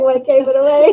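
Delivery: high, loud, laughing mock question; cracking up at her own joke; laughing retort. To the waitress who saw through her, a loud laughing question. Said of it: "I was like".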